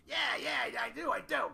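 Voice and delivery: high, shouted Bob voice; a loud, high-pitched impression of an old friend. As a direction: do your buddy Bob, high and eager. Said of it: "That's my Bob impression".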